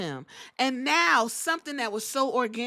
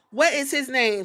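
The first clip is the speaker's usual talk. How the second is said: ordinary talk, nothing marked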